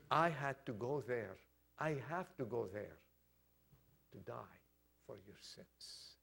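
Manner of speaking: low, hushed words fading to almost nothing; solemn and hushed. Retold or imitated. Jesus telling Peter he had to die, solemn and hushed.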